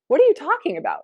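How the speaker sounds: high, loud incredulous question; taken aback. Retold or imitated. Student startled by teacher's question, incredulous. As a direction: blurt it out, baffled. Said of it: "she pops her head up".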